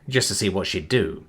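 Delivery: usual speaking voice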